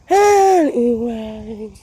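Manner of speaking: loud, slow anyway; loud slow anyway to move on; drawn-out dismissal. Real, not acted. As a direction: shrug it off, stretching the word.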